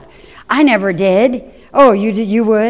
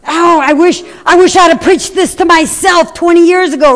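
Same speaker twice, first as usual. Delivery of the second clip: ordinary delivery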